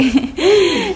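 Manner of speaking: high laugh. Real, not acted. Wife giggling over husband's gifts, real high laugh. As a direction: giggle as you remember it.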